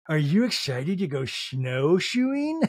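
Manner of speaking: slow, drawn-out eager question with rising end; mock excited question. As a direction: imitate an eager mom, stretching the words. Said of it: "Meredith's like".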